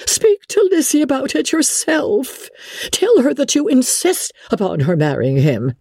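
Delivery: high, urgent matron's plea; agitated mother. Acted. Wife urging her husband to insist on the marriage, agitated. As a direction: start very high and press the demand.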